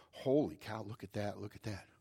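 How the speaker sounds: soft, low murmur of amazement; hushed awe. Retold or imitated. Crowd watching a strongman's feats, hushed awe. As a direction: let it fade to an awed undertone.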